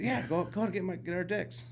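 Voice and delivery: high, eager insisting; eager demand. Retold or imitated. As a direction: urge him to go get it. Demanding guide fetch them.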